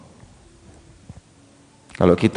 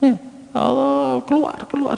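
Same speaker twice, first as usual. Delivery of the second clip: very high, thin mock female voice; falsetto, softer comic shooing; acted disgusted dismissal; 5 dB quieter than usual. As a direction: squeal softly to send him out. Disgusted woman throws him out.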